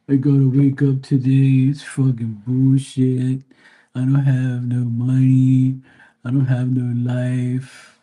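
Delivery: slow, high, drawn-out mock moan; mock complaining. Imitating ungrateful people facing another day, mock complaining.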